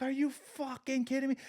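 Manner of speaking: disbelieving yell at TV. Retold or imitated. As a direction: throw up your hands at the screen in disbelief. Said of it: "I'm like".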